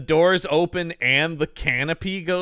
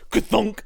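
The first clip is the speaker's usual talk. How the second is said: high mock printer thunk; 7 semitones higher than usual. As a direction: imitate a clunky printer spitting out a page. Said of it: "It just goes".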